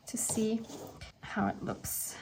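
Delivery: hushed, breathy whisper while concentrating; whispering to herself. Real, not acted. Crafter concentrating on stamping, whispering to herself. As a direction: whisper under her breath as she works.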